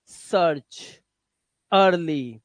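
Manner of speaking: ordinary delivery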